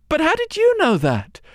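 put-on high, astonished young girl; startled girl. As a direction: gasp the question out in wonder.

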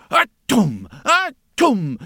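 strike-strike sound with a stick; mock beating noise. A narrator's reading.

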